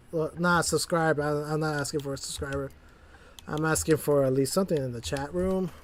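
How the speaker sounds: ordinary talk, nothing marked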